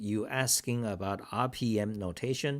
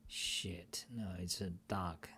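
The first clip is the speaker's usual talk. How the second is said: breathy muttered annoyance to yourself; a whispered aside, not for the audience; whispered curse; 10 dB quieter than usual. Real, not acted. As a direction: swear under your breath. Annoyed aside while browsing.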